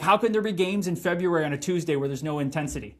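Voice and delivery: ordinary talk, nothing marked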